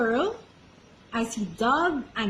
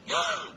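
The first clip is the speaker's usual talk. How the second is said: gasp; 4 dB quieter than usual. Acted. Falling into the mud.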